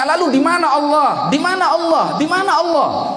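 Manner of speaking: high, insistent repeated shout. Retold or imitated. Slave shouts at Ibnu Umar, high repeated outcry. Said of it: "Dia teriak-teriak begitu".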